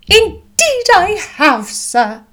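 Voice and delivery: high, loud, slow and drawn-out matronly voice; fussing mother. A narrator's reading. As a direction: play an overwrought mother making much of it. Voices Mrs Bennet answering Bingley.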